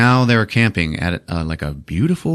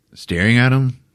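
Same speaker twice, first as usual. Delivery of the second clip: normal talking voice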